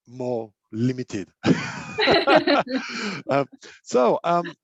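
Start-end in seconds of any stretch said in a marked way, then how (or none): 1.3-3.2 high burst of self-conscious laughter. Real, not acted. Coach laughing about his old English. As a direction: laugh it off at himself.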